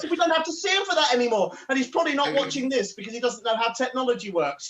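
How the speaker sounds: usual speaking voice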